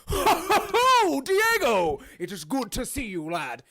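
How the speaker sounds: booming high "ho ho ho" easing down; big jolly welcome, drawn-out name; hearty greeting. Acted. Figure coming to life greets Diego, hearty booming welcome.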